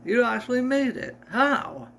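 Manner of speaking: high, amazed exclamation; high-pitched disbelief. Acted. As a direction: sound truly astonished. Surprised at brother's jump.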